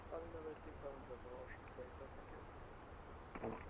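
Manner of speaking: over a pilot headset. Real, not acted. Pilot readback over radio.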